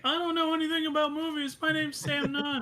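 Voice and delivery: high-pitched streaker's holler; loud yell. Acted. A streaker yelling to everyone at the gas station, loud high yell. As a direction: yell it out very high, drawing out the end. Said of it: "loudly yells to all of you".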